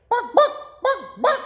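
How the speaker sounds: high, loud yappy barks; dog barking. Acted. Narrator barks as the noisy dog. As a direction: bark like an excited little dog.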